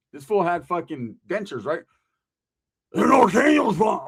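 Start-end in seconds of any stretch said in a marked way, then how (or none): 2.9-4.0 yelled warning. Retold or imitated. Toothless Roach yelling the news.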